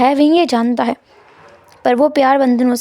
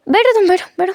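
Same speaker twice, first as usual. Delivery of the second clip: 1 flustered pleading; 8 semitones higher than usual. A narrator's reading.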